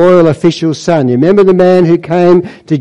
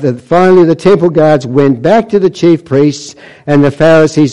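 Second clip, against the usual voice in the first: usual speaking voice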